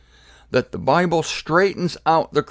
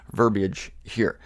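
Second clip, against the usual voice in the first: normal talking voice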